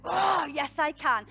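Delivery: rousing, full-voiced declaration; fierce self-belief. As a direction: burst out with empowered conviction. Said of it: "that energy in you that goes like".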